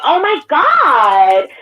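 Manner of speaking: high, drawn-out gushing stranger; put-on camper voice. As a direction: imitate an over-eager camper, stretching the words. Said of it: "Where is this new voice coming from? That don't sound like you".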